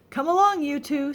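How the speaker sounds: put-on bright, high, cheery call to the kids; lively storybook housekeeper; Amelia Bedelia voice. A narrator's reading. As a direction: sing out the invitation. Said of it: "said Amelia Bedelia".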